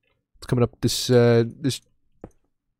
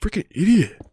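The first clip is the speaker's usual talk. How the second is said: mocking himself; 8 semitones higher than usual.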